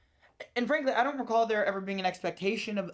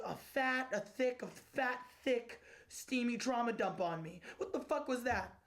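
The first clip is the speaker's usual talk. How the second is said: ordinary delivery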